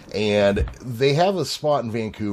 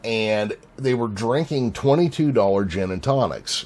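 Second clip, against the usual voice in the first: ordinary talk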